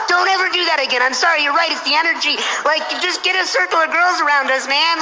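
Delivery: high-pitched, pleading mock panic; friend freaking out. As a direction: beg frantically, imitating a scared friend. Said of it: "he's, like, freaking out".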